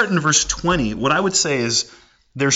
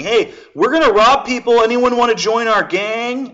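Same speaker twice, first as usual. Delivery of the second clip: higher, louder open invite; mock loud announcement; 8 semitones higher, 5 dB louder than usual. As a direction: call it out openly in a public square.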